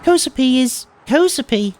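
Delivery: put-on high, sweet girl insisting; matter-of-fact cute declaration; cutesy girl voice. Transfer student correcting him, high cutesy voice.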